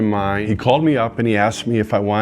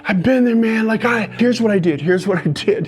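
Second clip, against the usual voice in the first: much higher, fast, eager; urgent higher interruption; eager fixer; 11 semitones higher than usual. Retold or imitated. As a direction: jump in to fix him. Impulse to fix him.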